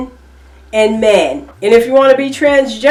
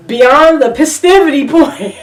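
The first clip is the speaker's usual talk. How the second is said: laughing at her own joke; words melting into a laugh; 6 semitones higher than usual. Real, not acted. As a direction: breaks into a high laugh.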